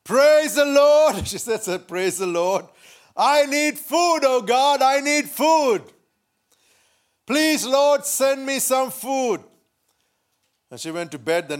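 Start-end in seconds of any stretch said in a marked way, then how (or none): 0.0-1.3 high, loud, drawn-out praise; porch shout. Acted. Poor old lady shouting on her porch, loud. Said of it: "she shouted on the porch". 3.2-9.4 high, raised-voice plea, stretched out; pleading prayer. Acted. Poor old lady praying for food, high pleading cry. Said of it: "she prayed to God for some assistance".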